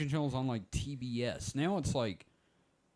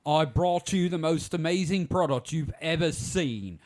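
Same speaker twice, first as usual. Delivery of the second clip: louder, hard-selling TV pitchman voice; infomercial pitchman; 2 semitones higher, 7 dB louder than usual. Acted. Spoofing the sweater guy for friends, a louder hammed-up sales pitch. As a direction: sell it like a late-night product pitch. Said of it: "the dude in the Cosby sweater".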